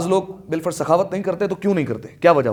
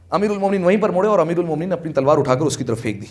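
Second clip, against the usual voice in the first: ordinary talk, nothing marked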